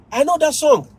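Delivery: high-pitched excited claim; eager student. Retold or imitated. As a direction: chime in like a keen pupil. Retold student recognising the song.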